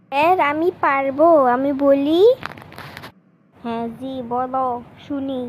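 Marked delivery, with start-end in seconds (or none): 0.1-2.2 loud, eager schoolboy asking to try; eager call-out. Acted. Boy eagerly begs the teacher to let him recite, loud. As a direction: shout it out, keen to recite.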